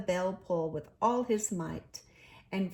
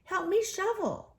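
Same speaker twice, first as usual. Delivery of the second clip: higher, urgent call; higher eager urging; eager cry; 12 semitones higher, 3 dB louder than usual. A narrator's reading. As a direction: call out for help digging. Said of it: "cried the rat".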